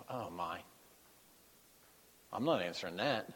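soft, low mock aside from Jesus; softly voiced dismissive aside. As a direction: say it dismissively under the breath. Jesus voiced ignoring the call.

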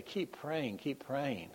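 whispered plea. Retold or imitated. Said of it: "I used to say".

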